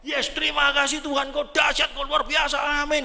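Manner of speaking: a husband shouting joyful thanks after good news; jubilant shout. Retold or imitated. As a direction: burst out in joyful praise.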